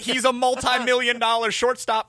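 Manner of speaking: emphatic reply from a replayed argument; retold exasperated comeback. Retold quarrel, his own heated comeback.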